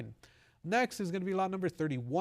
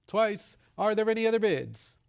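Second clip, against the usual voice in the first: raised call for last bids; 6 semitones higher, 6 dB louder than usual. Real, not acted. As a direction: call out to the room.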